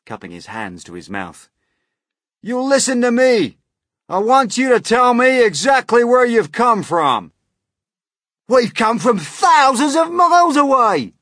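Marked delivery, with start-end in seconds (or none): 2.4-7.3 high, loud, demanding shout. A narrator's reading. Police chief questioning strangers high up, demanding shout. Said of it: "shouted the chief of police, cupping his hands". 8.5-11.1 very high, loud holler; shouting back. A narrator's reading. Centipede answering the police far below, shouting back. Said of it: "the centipede shouted back".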